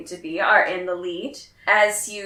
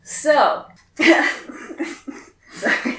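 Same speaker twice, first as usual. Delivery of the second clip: giggling through a sorry; can barely stop laughing; laughing apology; 11 semitones higher, 4 dB louder, slower than usual. Real, not acted. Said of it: "let me compose myself again".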